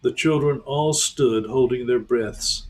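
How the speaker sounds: ordinary delivery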